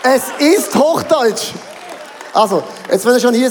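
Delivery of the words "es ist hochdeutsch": slow, high, emphatic "Hochdeutsch"; emphatic insistence. Real, not acted. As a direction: insist on every word of "Es ist Hochdeutsch", drawn out. Beteuert scherzhaft sein Hochdeutsch. ("Es ist Hochdeutsch" mit Nachdruck, langsam und gedehnt)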